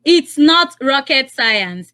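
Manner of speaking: slow, emphatic quiz phrase; deliberate quiz read; slow prompt. Shown as a demonstration. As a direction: spell the phrase out for the caller. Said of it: "How will you say it in Pidgin English?".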